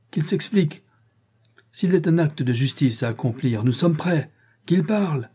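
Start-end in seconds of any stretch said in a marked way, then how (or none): 4.7-5.3 higher, insistent demand. A narrator's reading. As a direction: press him to speak. Demand repeated, rising.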